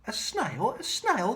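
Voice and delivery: startled teacher gasping; shocked teacher. Acted. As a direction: recoil at the sight of a snail. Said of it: "the teacher turns pale".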